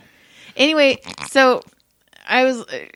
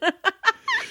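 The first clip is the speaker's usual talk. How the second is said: laugh; 12 semitones higher than usual. Real, not acted. Reacting to the nuts story.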